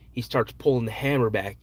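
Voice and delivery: usual speaking voice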